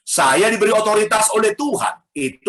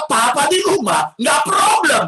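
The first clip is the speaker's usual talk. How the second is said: imitating kids; 8 semitones higher than usual. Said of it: "mereka berkata".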